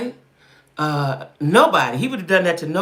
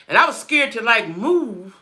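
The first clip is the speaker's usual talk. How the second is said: normal talking voice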